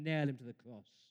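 usual speaking voice

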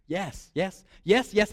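ordinary talk

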